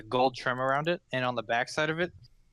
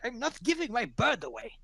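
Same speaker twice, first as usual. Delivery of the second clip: Laszlo's voice; 11 semitones higher than usual. Acted.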